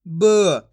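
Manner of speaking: "ब" loud and low, standing alone; isolated letter sound. Shown as a demonstration. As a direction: sound out "ब" with a short neutral vowel. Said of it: "एक एक अक्षर का उच्चारण करके".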